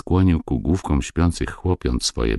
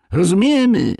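put-on very high, loud child's reply; obedient little boys; 5 dB louder than usual. A narrator's reading. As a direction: answer father promptly in a child's high voice. Boys answer father in chorus. (posłuszni chłopcy, wysoki głos)

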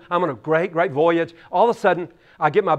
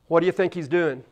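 ordinary delivery

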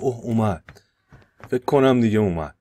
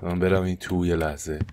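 ordinary talk, nothing marked

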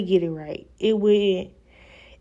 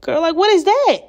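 much higher, louder what is that; shocked; 10 semitones higher, 6 dB louder than usual. Real, not acted. Staring at her rolling lace, shocked and loud. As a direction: exclaim in disbelief at the hair.

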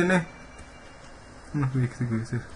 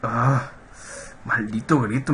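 usual speaking voice

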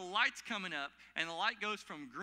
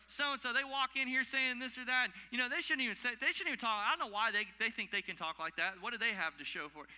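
higher, louder mock complaint; indignant busybody act, pitched up at first; judgmental griping; 5 semitones higher, 4 dB louder than usual. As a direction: imitate a critic griping about someone. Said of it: "we're so judgmental".

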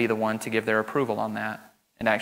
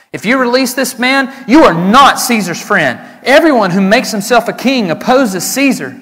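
much higher, louder protest; crowd shouting; 10 semitones higher, 9 dB louder than usual. Acted. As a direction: cry it out as a threatening mob.

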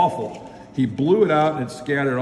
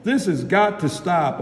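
raised, higher storybook shout; shouted order; 5 semitones higher than usual. A narrator's reading. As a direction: put your foot down as a fed-up officer. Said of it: "Officer Dooley shouted".

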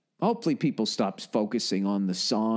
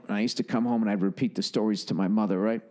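ordinary delivery

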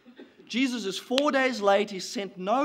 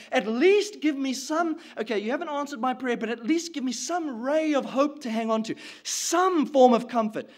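higher-pitched, insistent begging to God; desperate pleading; 3 semitones higher, 3 dB louder than usual. Acted. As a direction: plead with God, pressing harder as you go. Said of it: "you've pleaded".

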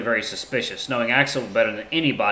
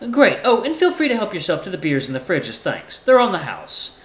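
higher-pitched, measured formal character; courteous Ty; 8 semitones higher, 4 dB louder than usual. Acted. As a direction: speak up high, politely composed. Voices Ty in story.

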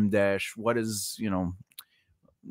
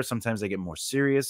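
ordinary talk, nothing marked

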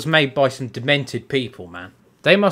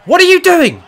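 loud, high shouted question; exasperated shout; 14 semitones higher, 8 dB louder than usual. Real, not acted. Gamer yelling at the fight, exasperated shout. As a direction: shout it in real disbelief.

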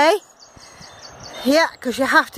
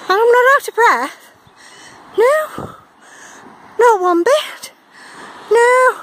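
high, loud breathless protest; ironic denial; 8 semitones higher, 3 dB louder than usual. Real, not acted. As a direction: insist you're fine while gasping. Insisting while plainly winded.

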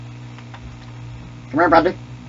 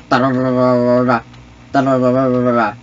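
rolled rat-a-tat at speaking pitch; mock gunfire noise; 3 semitones lower than usual. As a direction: trill two pretend bursts of fire. Imitates gunfire while playing.

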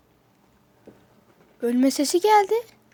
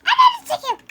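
short high-pitched cursing shout; sudden squawk of alarm; startled yelp; 3 dB louder than usual. Real, not acted. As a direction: blurt the curse in fright. Startled during gameplay.

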